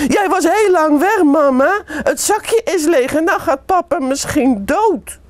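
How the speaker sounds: put-on high, loud child's outburst. A narrator's reading. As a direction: blurt it out, forgetting to whisper. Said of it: "Mick zegt het heel hard". (heel hard en hoog, als een kind)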